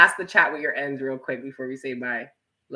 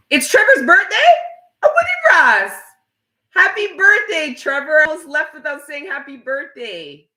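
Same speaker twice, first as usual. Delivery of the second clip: high, loud squeal of surprise; surprised excitement; 14 semitones higher, 9 dB louder than usual. Real, not acted. Streamer greeting Trevor on his birthday, excited high squeal. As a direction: gush over the birthday news.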